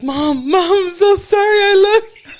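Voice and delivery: very high, loud, desperate wail; hammed-up teenage panic, voice soaring; frantic apology. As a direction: cry out to mom in terrified remorse. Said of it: "and I was like".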